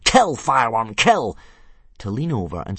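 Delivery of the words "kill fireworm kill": yelling. A narrator's reading. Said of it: "Snotlout broke off from yelling".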